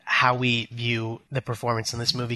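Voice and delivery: ordinary talk, nothing marked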